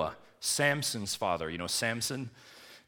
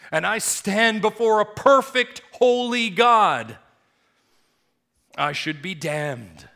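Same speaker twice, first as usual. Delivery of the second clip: loud, high, slow cry of guilt; a sinner crying out in dread; anguished outcry; 13 semitones higher, 15 dB louder, slower than usual. Acted. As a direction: cry it out before a holy God. Voiced sinner's anguished confession.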